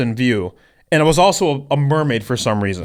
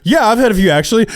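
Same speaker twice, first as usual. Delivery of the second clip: mock brag; 8 semitones higher, 4 dB louder, faster than usual. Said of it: "I like how that went from".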